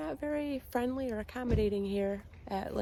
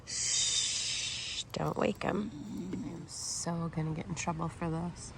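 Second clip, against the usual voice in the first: conspiratorial hushed whisper; sneaking in late; 3 semitones lower, 7 dB quieter, slower than usual. Real, not acted. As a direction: whisper so you don't wake him. Sneaking s'mores while Lance sleeps.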